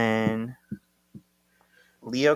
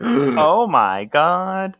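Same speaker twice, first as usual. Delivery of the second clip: slow, higher stunned oh my God; astonished; 8 semitones higher, 6 dB louder, much slower than usual. Real, not acted. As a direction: let the shock sink in. Reaction to Benjamin reveal.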